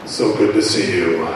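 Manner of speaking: mock a stiff, lifeless believer; low, flat-toned mock piety; stoic pious brother. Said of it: "reduce your concept of joy down to stoic peace".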